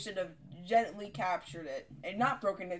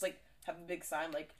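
normal talking voice

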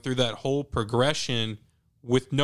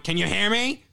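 higher-pitched imitation of calling down the phone; mock shout; 7 semitones higher, 3 dB louder than usual. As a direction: imitate a mom shouting to be heard, rising pitch. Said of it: "shouting at each other".

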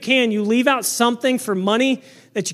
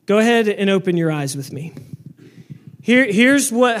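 normal talking voice

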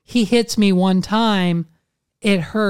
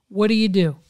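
normal talking voice

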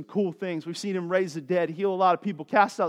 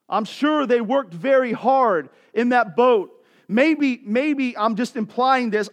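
louder, impassioned preaching; 7 semitones higher, 8 dB louder than usual. Acted. Pastor urging his congregation to trust Jesus, impassioned preaching. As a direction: preach it out to the church.

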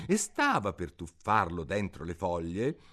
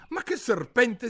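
high, louder indignant retort; 8 dB louder than usual. Acted. Alice protests the pigeon's insult, indignant. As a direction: bristle at the insult. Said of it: "disse Alice indignata".

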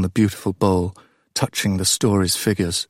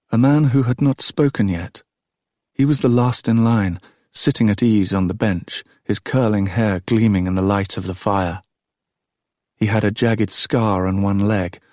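ordinary delivery, nothing marked

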